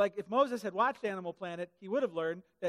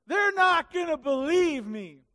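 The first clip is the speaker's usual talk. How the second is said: slow, higher and louder whiny complaint; mocking whine; 6 semitones higher, 9 dB louder, slower than usual. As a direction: drag out the excuse mockingly.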